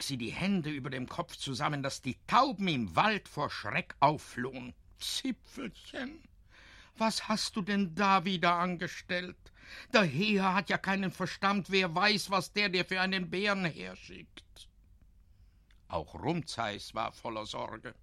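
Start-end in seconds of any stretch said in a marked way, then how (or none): 5.0-14.7 a fretful mum scolding her son; worried scolding mother. A narrator's reading.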